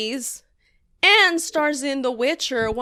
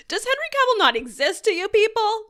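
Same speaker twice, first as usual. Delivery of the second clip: high-pitched incredulous question; mock outrage; 8 semitones higher than usual. Comedian confronting People magazine over the snub, incredulous. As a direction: plead in exaggerated disbelief.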